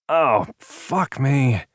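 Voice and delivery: slow pained curse; pained groan. A narrator's reading. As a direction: curse after banging your head. Hits head on table.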